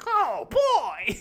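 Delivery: high, loud gleeful yelp; excited bug voice. Retold or imitated. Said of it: "but he gets excited".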